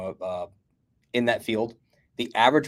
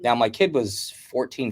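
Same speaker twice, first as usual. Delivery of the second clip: ordinary delivery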